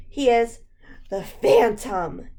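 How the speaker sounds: lightly hammed-up spooky phantom, slow at the end; mock scary voice. A narrator's reading. Zeke teasing Brooke on the phone, mock-scary phantom voice. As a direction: tease her with a spooky drawn-out reveal. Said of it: "in a deep, scary voice".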